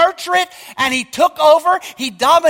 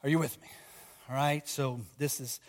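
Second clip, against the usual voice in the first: drops to a much softer, lower pastoral voice; quiet urging, calling the flock back in; softer closing exhortation; 10 semitones lower, 12 dB quieter than usual. Real, not acted. Pastor to congregation, much softer and lower urging.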